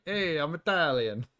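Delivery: slow, mock Italian accent. Explaining the joke to chat, slow mock Italian accent. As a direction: lay on a generic movie Italian. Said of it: "generic Italian, like, stereotype voice".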